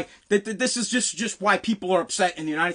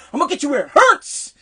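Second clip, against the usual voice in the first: higher, emphatic threat landing hard on "hurts"; imitated caddy master threat; 4 semitones higher, 4 dB louder than usual. Caddy master pointing at a young caddy, threatening. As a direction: mimic his pointed warning, no stammer. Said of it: "He said it just like that".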